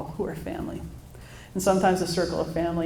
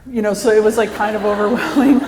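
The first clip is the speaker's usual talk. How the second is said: louder, laughing through it; 4 semitones higher, 11 dB louder than usual. Real, not acted. Mother laughing loudly about feeling overwhelmed. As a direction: burst into laughter at the memory.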